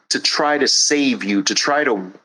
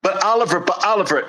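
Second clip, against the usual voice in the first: higher, pleading mock whine; whiny protest; 6 semitones higher, much faster than usual. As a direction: whine like a stalling kid. Whiny objections in acted argument.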